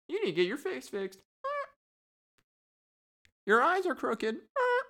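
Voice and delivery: high mocking parrot voice, the sneer rising; mean parrot taunts. Parrot roasts the public's looks, high mocking squawk. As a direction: keep jabbing the visitors in a high bird voice. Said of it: "They were s".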